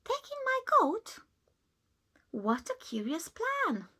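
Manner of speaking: put-on high, incredulous old lady; baffled by the odd advice; puzzled surprise. Old lady doubting the wise man's plan, incredulous.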